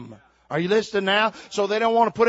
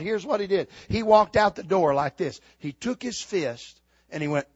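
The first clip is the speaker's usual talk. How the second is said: usual speaking voice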